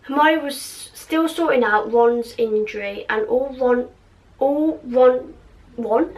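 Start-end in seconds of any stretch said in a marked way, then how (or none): 3.3-5.3 Ron slipping into "Won"; mispronounced Ron. Real, not acted. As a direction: stumble on Ron, saying it like "Won". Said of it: "I can't even say Ron now".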